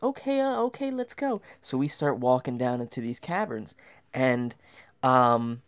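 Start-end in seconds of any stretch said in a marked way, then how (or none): 0.0-1.4 high, timid agreement; high nervous voice. Retold or imitated. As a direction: squeak out a scared okay. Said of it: "I'm like".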